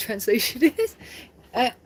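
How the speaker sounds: usual speaking voice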